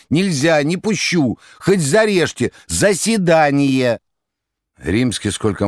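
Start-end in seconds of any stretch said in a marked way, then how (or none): 0.1-4.0 high, loud desperate yelling; desperate shout. A narrator's reading. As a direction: shout it as if blocking the door. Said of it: "отчаянно кричала".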